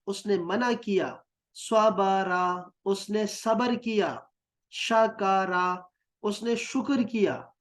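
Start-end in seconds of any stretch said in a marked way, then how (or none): 1.5-2.7 सबारा stretched very slowly, each syllable long; drawn out. Shown as a demonstration. Teacher slowly models the word for students. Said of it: "हर हर्फ पे आपने ज़बर लगानी है". 4.7-5.9 सबारा stretched very slowly, each syllable long; drawn out. Shown as a demonstration. Teacher slowly models the word for students. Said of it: "हर हर्फ पे आपने ज़बर लगानी है".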